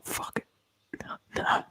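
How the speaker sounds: usual speaking voice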